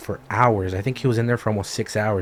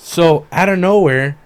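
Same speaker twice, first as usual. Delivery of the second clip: dramatic build-up; 8 semitones higher, 8 dB louder than usual. Real, not acted. Dramatic turn in story.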